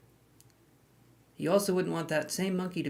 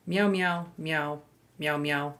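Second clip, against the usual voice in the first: string of playful meows; speaking cat; slower than usual. Pretend. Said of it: "That is Cat Anise".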